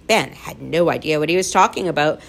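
ordinary delivery, nothing marked

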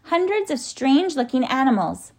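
high-pitched urgent report; excited cry. A narrator's reading. Tom spots strange animals from the tree, excited cry. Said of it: "cried Tom".

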